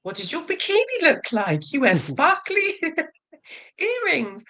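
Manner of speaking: put-on a delighted little girl asking about the sparkly bikini; girly daughter. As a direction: play the curious young daughter. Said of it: "it was kind of like".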